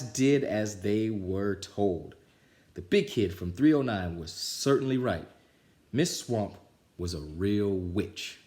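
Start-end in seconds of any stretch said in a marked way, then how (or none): none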